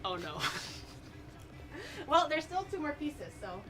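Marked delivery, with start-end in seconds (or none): none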